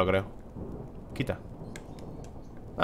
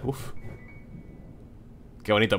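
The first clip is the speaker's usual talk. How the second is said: ordinary delivery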